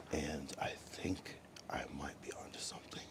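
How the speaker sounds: hushed whisper under the breath. Real, not acted. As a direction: breathe it out as a secret. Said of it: "Whispering under your breath".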